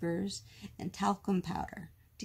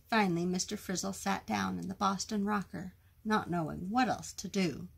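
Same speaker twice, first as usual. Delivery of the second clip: plain reading voice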